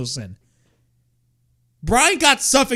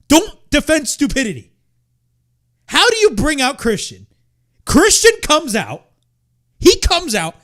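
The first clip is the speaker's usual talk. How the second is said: loud, heated, hammering each sentence; real frustration boiling over, louder; exasperated loud ranting; angry rant; 4 semitones higher, 5 dB louder than usual. Real, not acted. Furious rant about booking.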